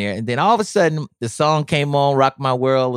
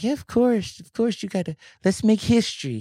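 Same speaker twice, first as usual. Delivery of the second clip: [name] voice; 9 semitones higher than usual. Retold or imitated. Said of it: "he would be like".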